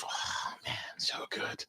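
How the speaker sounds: breathy whisper of pure delight; hushed savoring. Real, not acted. Remembering the fried ahi poke, a savoring whisper. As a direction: whisper it like the taste is still there.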